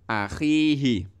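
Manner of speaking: Akhihi slow, the khi stretched; teacher showing a long vowel. Shown as a demonstration. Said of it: "khi-nya itu panjang".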